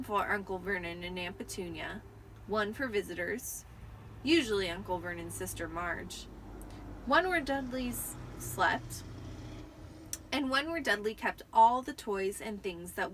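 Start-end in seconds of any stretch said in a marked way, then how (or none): none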